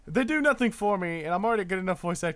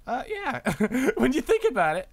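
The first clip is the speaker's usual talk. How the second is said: laughing; 8 semitones higher, 4 dB louder than usual. Real, not acted. Laughing at team being gone.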